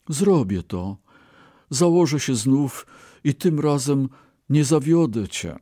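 eager promise, rising high in pitch; exclaiming Brahmin. A narrator's reading. Brahmin promises his ox he will not fail, exclaiming. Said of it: "wykrzyknął Bramin".

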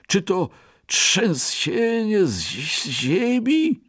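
slow, high, anxious snake voice; uneasy snake. Acted. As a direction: ask nervously, lifting its head. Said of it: "z niepokojem". (zaniepokojony wąż)

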